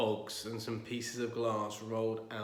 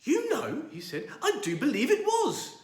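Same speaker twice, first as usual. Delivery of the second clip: amazed; 8 dB louder than usual. Acted. Amazed old man.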